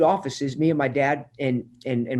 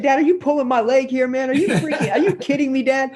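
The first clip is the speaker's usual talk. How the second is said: laughing disbelief; 9 semitones higher than usual. Retold or imitated. Retelling disbelief at the office number.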